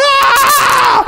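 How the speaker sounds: high, long frenzied scream; re-enacted wild scream. Preacher didn't know what to do, frantic scream. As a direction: let out a helpless scream. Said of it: "I was just screaming".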